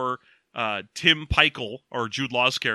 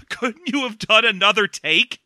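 higher and louder, incredulous; mock exasperation; 7 semitones higher, 5 dB louder than usual. A viewer asking the filmmakers why nobody redid the take, exasperated. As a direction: ask it like a fed-up viewer. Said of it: "And you're like".

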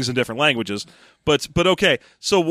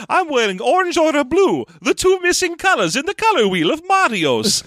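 Mario-style voice; 11 semitones higher than usual. Acted. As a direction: ham it up like Mario.

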